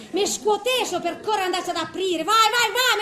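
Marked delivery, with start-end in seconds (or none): 2.2-3.0 loud, high, breathless urging; egging herself on to run to the door; eager urging. Acted. Old woman rushing to answer her sweetheart, excited.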